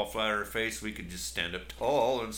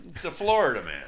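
ordinary talk

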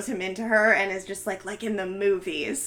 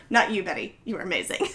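ordinary delivery

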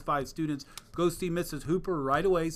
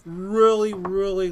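excited; 6 semitones higher, 12 dB louder, slower than usual. Real, not acted.

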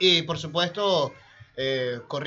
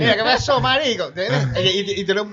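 put-on Caracas malandro accent; 3 semitones higher, 4 dB louder than usual. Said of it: "así hablan caraqueño".

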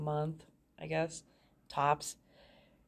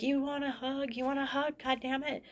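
much higher, sneering retold jab at the husband; imitating a drunk wife's angry taunt; high-pitched mock snarl of "you want a hug"; mock angry hug offer; 9 semitones higher, 4 dB quieter than usual. Drunk wife mock-comforting aggressively.

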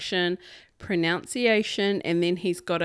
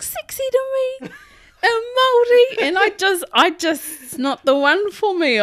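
laughing; 8 dB louder than usual. Real, not acted. Laughing, mocking his sexy talk.

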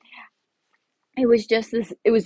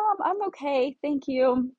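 high, polite brush-off; retold polite decline; 6 semitones higher than usual. Customer declining the laundromat lady's price, high polite voice.

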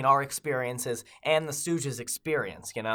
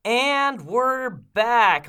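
slow announcer; 8 semitones higher, 6 dB louder, much slower than usual. Acted. Host returns from break.

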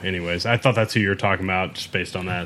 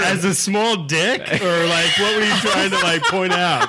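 teasing laugh; 8 semitones higher, slower than usual. Real, not acted.

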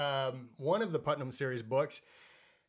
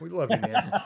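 laughing; 3 semitones higher, 5 dB louder than usual. Real, not acted. Shoutout to friend, laughing.

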